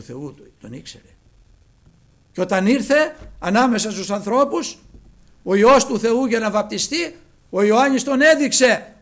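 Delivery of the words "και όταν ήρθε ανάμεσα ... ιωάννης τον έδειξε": loud and high, slowing at the end; preaching at full force. Acted. Preacher telling the baptism story, loud preaching. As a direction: build the sermon to a climax. Building sermon climax.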